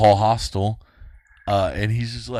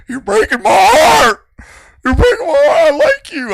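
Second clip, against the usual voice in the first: high, loud, wailing fake sobs; hammed-up fake sob on the phone; fake crying; 10 dB louder than usual. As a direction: wail it like a staged breakdown. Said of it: "I just fake cried for that".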